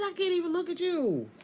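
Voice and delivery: high-pitched mock indignation; mock pissed-off. Reader relaying the angry partner's words, mock pissed-off. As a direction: exclaim it high, acting fed up. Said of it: "He is pissed off at you".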